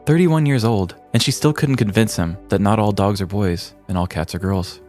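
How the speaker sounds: ordinary delivery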